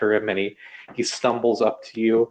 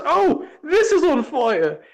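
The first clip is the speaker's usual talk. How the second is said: very high, slow, loud sudden alarm; alarmed Lionel; 4 dB louder, slower than usual. A narrator's reading. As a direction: realise the fire all at once. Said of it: "he goes".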